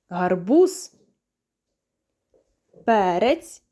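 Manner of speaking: name each vegetable slowly so toddlers can repeat; teacher naming flashcards, slow and loud; clear naming for kids. Shown as a demonstration. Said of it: "впізнаємо та називаємо".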